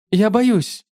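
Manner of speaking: high, frightened admission; afraid. A narrator's reading. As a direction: confess the fear. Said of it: "Странная дрожь звучала в голосе".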